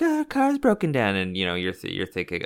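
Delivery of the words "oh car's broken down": put-on high, sweet stranded-girl voice; helpless girl voice. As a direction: put on a high, innocent whine. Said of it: "they're like".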